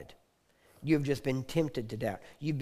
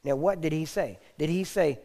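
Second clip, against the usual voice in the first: ordinary delivery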